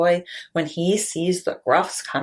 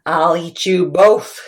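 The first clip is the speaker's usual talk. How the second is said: louder troll growl; troll threat; 7 dB louder than usual. Acted. Troll threatening both goats, louder. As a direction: bark it hungrily.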